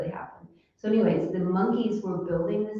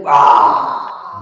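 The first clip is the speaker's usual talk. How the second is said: low loud wah; mock monkey wah; 7 semitones lower, 11 dB louder than usual. The monkey startled by the spider, a low loud wah. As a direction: bellow wah like a big monkey. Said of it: "the monkey was like".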